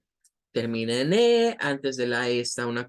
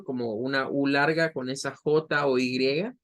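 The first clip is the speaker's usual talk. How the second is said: normal talking voice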